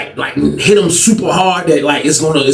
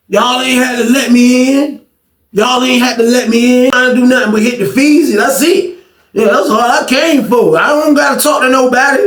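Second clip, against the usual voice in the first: high, cocky mock voice of a small loudmouth; brushing everyone off in a put-on high voice; mocking the little guy; 6 semitones higher than usual. Inmate mocking the short guy who barged in, high cocky voice. Acting out the small guy's boast.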